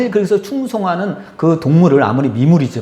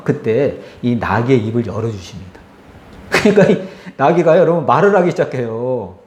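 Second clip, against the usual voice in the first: normal talking voice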